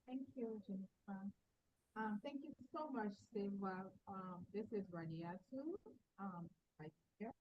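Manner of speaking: faint, far-off mic voice; speaking far from the microphone; barely audible. Real, not acted. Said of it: "Your voice is very low".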